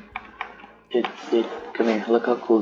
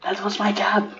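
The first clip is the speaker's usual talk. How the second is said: high squeaky voice; 11 semitones higher than usual. Pretend. Mock protest in a high voice.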